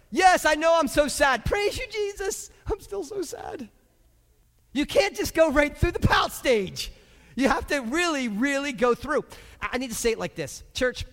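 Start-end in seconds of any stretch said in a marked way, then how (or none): 0.1-3.7 high-pitched, hammed-up sobby praise; exaggerated high, pitiful whine; mock wailing. Mimics praising while still sad. 4.8-9.2 raised, high, forceful preaching; loud, urgent exhortation; emphatic preaching. Real, not acted. Preacher driving point home.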